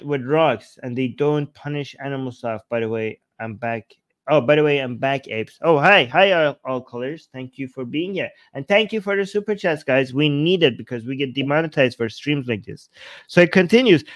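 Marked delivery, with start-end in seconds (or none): none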